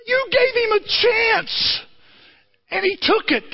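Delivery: high, slow, crying shout of frustration; high slow cry of mock despair; mock anguish. Real, not acted. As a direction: wail your disgust at the film. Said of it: "I hate this movie".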